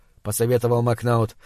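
plain reading voice

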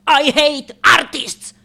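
loud, high, slow and emphatic; furious, drawn-out declaration; hateful outburst. Acted. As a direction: spit it out as a climax. Climax of rant against artists.

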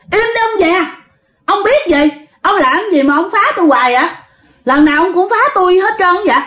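high, fast, irritated back-talk; defiant retort. Acted. Ghost tells the meddling old man to shut up, high and defiant. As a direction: snap back at someone who keeps meddling. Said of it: "Nó mới nói vậy nè".

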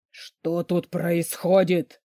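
slow, controlled male anger; hoarse with anger. A narrator's reading. Boss walks in on the quarrel, slow restrained anger. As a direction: demand it slowly, holding the anger in. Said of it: "Хриплый голос великана дрожал от злости".

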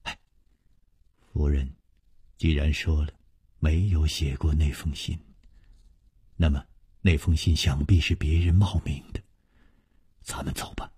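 low, soft, fading to a whisper; quietly tug him away, almost whispering; hushed urging. A narrator's reading. Nangong Ling quietly urges Chu Liuxiang to leave, whispering. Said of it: "南宫绫悄悄地一拉".

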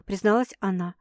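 ordinary delivery

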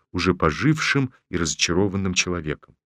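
usual speaking voice

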